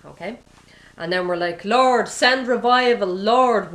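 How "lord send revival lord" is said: loud, high, drawn-out cry to God; hammed-up revival prayer; mock fervent plea. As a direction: call out to heaven, stretching the words. Acting out people pleading for revival.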